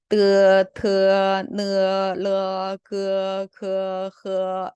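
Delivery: 뜨, 트, 느, 르, 그, 그, 흐 chanted level, each ending in 어; eu-to-eo glide. Shown as a demonstration. Teacher repeats the drill for students, gliding 뜨, 트, 느, 르, 그, 그, 흐. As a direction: drill 뜨, 트, 느, 르, 그, 그, 흐, opening each vowel. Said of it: "이렇게 읽어야 돼요".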